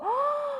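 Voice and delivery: high storyteller gasp of astonishment; amazed gasp. Acted. Storyteller as disciples see Peter standing, astonished gasp. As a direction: draw in a surprised breath.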